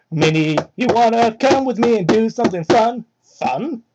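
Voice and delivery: normal talking voice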